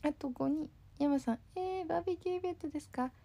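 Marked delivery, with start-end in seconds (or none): 1.5-3.1 higher, fast mock surprise, soft; mimicking Yama. Streamer mimics Yama pretending not to know the barbecue, mock surprise. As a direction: play Yama acting clueless about the event. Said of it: "って".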